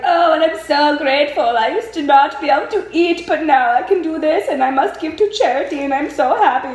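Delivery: sing-song sarcastic impression of a gushing heroine; mocking whiny Feyre. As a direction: parody her gushing gratitude in a put-on voice. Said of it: "out here being like".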